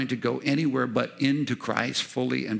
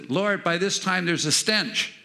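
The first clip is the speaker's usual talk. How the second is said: higher-pitched objection as Martha; mock protest; 8 semitones higher, 3 dB louder than usual. Preacher voices Martha objecting at the tomb, high mock protest. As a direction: push back in a raised, protesting voice.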